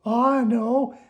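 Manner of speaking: high worm voice, sulky; a little worm announcing he's leaving; Slimy the worm. Acted. Slimy tells Oscar he's leaving, in a high sulky voice.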